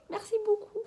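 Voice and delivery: high, sugary little merci beaucoup; cute high thanks. Real, not acted. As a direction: thank her in a sweet high voice. Remercie son amie en vidéo. (merci aigu et mignon)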